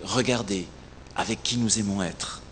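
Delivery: normal talking voice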